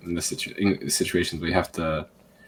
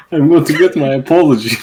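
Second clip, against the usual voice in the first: loud, high mock customer voice; indignant customer; 9 semitones higher, 12 dB louder than usual. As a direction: play a customer demanding satisfaction, loudly. Voices customer returning for an apology.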